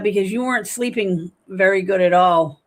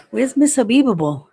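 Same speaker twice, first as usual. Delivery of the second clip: higher and louder call across the room; calling for her; 4 semitones higher, 7 dB louder than usual. Retold or imitated. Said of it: "people are like".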